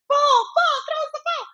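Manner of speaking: put-on very high-pitched kids' chant; clamoring kids. Mom imitating kids hounding the pitcher, high clamor. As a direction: imitate a crowd of children calling out. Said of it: "all the kids were like".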